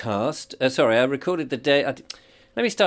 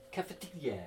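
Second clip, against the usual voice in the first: cafetiere said softly, higher, in French style; soft mock-French cafetiere; French accent; 3 semitones higher, 15 dB quieter than usual. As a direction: try "cafetiere" as a French word. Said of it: "doing it in a French accent".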